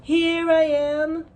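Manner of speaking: high, loud, slow calling voice; distant voice. A narrator's reading. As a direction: call out from somewhere unseen. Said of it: "a voice said".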